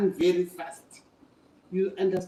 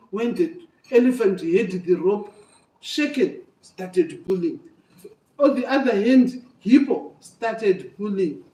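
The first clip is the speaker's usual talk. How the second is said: plain reading voice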